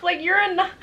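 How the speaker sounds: half laugh, half cry, overwhelmed; laughing through tears. Real, not acted. Overcome while gushing.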